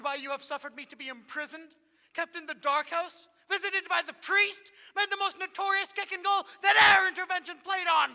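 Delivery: high, loud list of grievances climbing; outraged, building to shout. Acted. Imprisoned steward demanding answers of his lady, furious shout.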